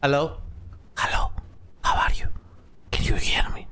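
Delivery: whispered mic check; voiceless, careful whisper. Real, not acted. Testing the mic without screaming.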